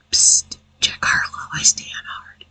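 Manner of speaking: secretive whispered aside. Real, not acted. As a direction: lean in and whisper it.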